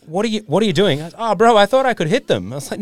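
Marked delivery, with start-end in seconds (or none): 0.1-1.0 high, loud what are you doing; alarmed at a reckless shot; retold alarm at a friend firing from a moving car; shocked disbelief. 1.2-2.5 mock Spanish accent, pitched high; a hammed-up Spanish accent. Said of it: "why he sounded Spanish".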